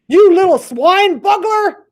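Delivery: very high, loud, slow snarl; furious giant. Acted. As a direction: roar the insult. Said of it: "warned the blood bottler".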